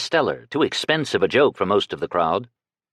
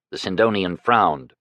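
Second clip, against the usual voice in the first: usual speaking voice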